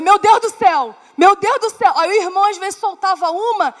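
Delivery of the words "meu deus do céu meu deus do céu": loud exasperated cry to God; exasperated exclamation. Retold or imitated. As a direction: blurt it out in frustration.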